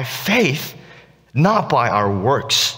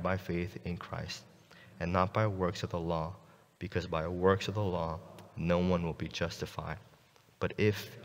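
scripture reading; 7 semitones lower, 16 dB quieter than usual. Acted. Pastor reads Galatians to the congregation, soft and solemn. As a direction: read the verses with quiet reverence.

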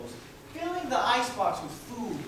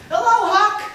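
high, loud cheery greeting; voiced mirror greeting; 14 dB louder, slower than usual. Tailor shop mirror greeting the boy, high and loud. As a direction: call it out bright as a shop window. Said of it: "the mirror in the tailor shop said".

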